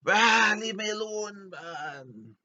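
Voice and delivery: high, loud, drawn-out whine; hammed-up nerd outburst; mock nerd rage. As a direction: lash out like a nerd.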